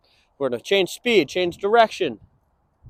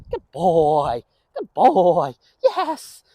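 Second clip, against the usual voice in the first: ordinary talk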